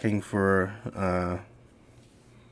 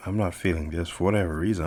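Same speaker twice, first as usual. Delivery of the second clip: usual speaking voice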